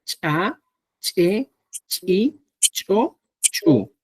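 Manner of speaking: "Cha, che, chi, cho, chu" each with a popped glottal ch'; glottalized ch. Shown as a demonstration. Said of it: "los glotalizados".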